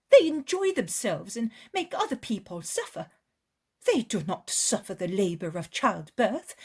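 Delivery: an upset woman, high and loud, almost crying; bitter lament. Acted. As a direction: pour out a poor mother's grievance.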